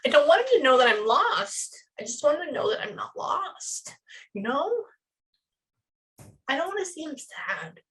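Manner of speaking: a hint of a pleading boy; son's voice. Medium channeling the son to his father, lightly pleading. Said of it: "That's what he sounds like".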